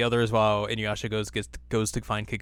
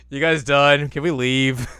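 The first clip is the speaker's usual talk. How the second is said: impatient stoner; 4 semitones higher, 8 dB louder, slower than usual. Acted. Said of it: "Moroku shows up blazed out of his mind".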